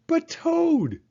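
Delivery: high, loud protest; crying out. Acted. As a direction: plead with a friend going back to bed. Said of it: "cried Frog".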